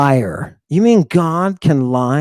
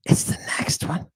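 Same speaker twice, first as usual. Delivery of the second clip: whisper; 8 dB quieter than usual. Acted. Repeats line under breath.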